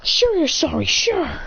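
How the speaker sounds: bitter sarcasm; sarcastic. Acted. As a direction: scoff at the apology. Sarcastic dismissal of apology.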